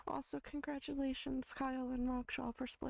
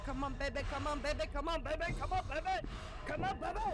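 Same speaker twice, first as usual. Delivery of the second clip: pleading that climbs ever higher; desperate pleading; 4 semitones higher than usual. Real, not acted. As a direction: build the begging up to a squeal. Pleading building toward six AM.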